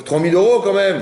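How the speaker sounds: high, loud, affronted outburst; indignant protest. Acted. Husband whose costly gift got little thanks, indignant. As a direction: protest the cost in mock outrage.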